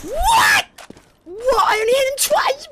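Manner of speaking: very high-pitched disbelief; incredulous squeal. Real, not acted. Gamer only hit him twice, incredulous squeal.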